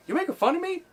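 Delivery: very high squeaky challenge; confronted guy. Retold or imitated. Tough guy confronts the comedian, squeaky high challenge. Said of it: "and he's like".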